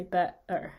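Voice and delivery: "better" with a little pause in the throat for the T; glottal stop. Shown as a demonstration. Teacher demonstrating the throat plosive, glottal stop. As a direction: swallow the T in "better". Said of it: "but it's in our throat".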